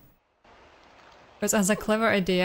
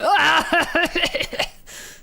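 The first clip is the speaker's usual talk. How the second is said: loud laugh; 7 semitones higher, 4 dB louder than usual. Real, not acted. Reacting to game.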